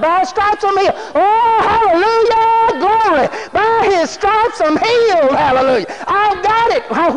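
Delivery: loud, high, exultant declaration; full-force preaching. Real, not acted. Preacher claiming healing for the congregation, loud and exultant. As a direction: proclaim it with rising praise shouts.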